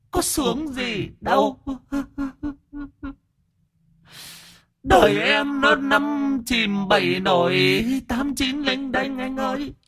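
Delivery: very high, soft, drawn-out lament; tearful ghost sobbing. Acted. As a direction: wail your hard life in a weepy falsetto. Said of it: "rồi bắt đầu nó thút thít khóc". (giọng ma thút thít, than khổ)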